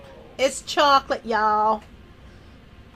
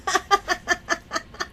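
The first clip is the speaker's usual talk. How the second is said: long run of quick chuckles; giggle; 3 semitones higher, 4 dB quieter than usual. Real, not acted. Streamer joking about going broke, giggling. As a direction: chuckle along at your own remark.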